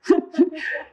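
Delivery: short high laugh, amused. Real, not acted. Pastor laughing at his own story, high laugh. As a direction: laugh at the funny memory.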